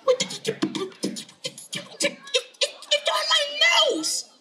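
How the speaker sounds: put-on very high, halting child voice; high-pitched kid in dismay; shocked little boy. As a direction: squeak it out in broken bursts. Tommy shocked at his nose.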